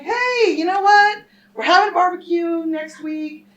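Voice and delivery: high, loud overly chirpy small talk; fake cheerful invite. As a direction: act like old friends for the cops. Pretending to know the man.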